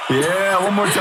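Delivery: high, emcee-style whoop; playful cheering. Pretend. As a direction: hype up an imaginary crowd. Mock crowd cheer for Dublin.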